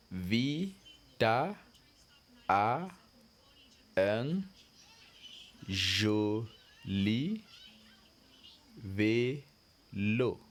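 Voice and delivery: Vita a un joli vélo, each word split by a pause; word-by-word reading again. Shown as a demonstration. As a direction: repeat it word by word for the class.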